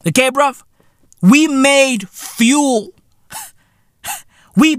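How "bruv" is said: normal talking voice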